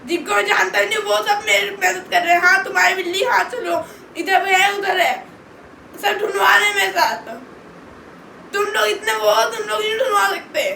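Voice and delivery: real heated outburst; angry, upset tirade. Real, not acted. Berates family for not helping search.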